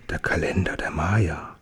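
soft, hushed whisper in wonder; awed whisper. Acted. Orakelchen whispers in awe over the stone calendar. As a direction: breathe it out in awe over the find. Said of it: "er flüstert".

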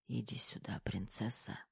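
very soft, weak murmur; hushed words from a weak patient; barely audible whisper. A narrator's reading. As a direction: whisper it almost inaudibly. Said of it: "едва различимо прошептал Кирилл".

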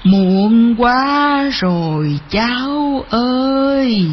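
very slow, wailing farewell; sorrowful grandmother bird. Acted. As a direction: draw out each phrase like a calling bird. Grandmother bird refuses, departs.

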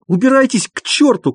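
high-pitched cry close to tears; tearful shout. A narrator's reading. Doctor chasing his visitors out, tearful shout. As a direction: cry out in weeping rage. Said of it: "крикнул он плачущим голосом".

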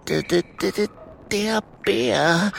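high, halting, frightened stutter; stammering whisper. A narrator's reading. Kokosnuss warns of the bear, stammering whisper. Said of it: "flüstert Kokosnuss".

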